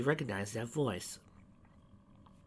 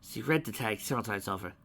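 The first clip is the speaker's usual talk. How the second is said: ordinary delivery, nothing marked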